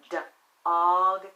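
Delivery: D-og split into its sounds, d then og; sounded out. Shown as a demonstration. Teacher sounding out D-og for young readers. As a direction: tap out D-og sound by sound. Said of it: "we can tap it out".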